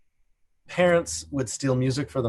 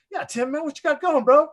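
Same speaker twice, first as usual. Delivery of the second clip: high, louder breezy bro voice; hammed-up casual celebrity; 13 semitones higher, 4 dB louder than usual. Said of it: "[name]'s like".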